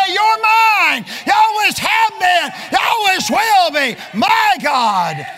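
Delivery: loud, high preacher's shout; fiery preaching. Acted. Preacher crying out to the congregation, loud and high. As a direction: preach it full force from the pulpit.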